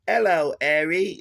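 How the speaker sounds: loud, high, hammed-up upper-class English; mock posh English. Mocking an actress's posh English greeting, loud and high. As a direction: ham up a bad posh English lady. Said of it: "she goes".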